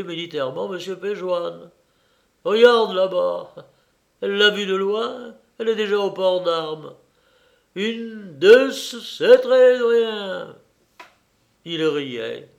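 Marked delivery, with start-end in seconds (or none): 2.4-10.6 louder, raised voice, pointing out a sight; mock military excitement. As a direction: build to a slow, loud drawn-out count. Old man gleefully mimics sentry's salute.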